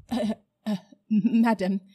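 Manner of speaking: flustered, breathless stammer; nervous gasp. Acted. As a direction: gasp it out, caught off guard. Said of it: "gasped Uncle Andrew".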